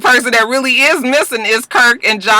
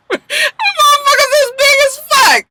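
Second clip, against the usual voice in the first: very high, squealing through laughter; real laughing shriek. Real, not acted. Vlogger losing it over her own joke, laughing. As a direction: crack up and squeeze the words out high.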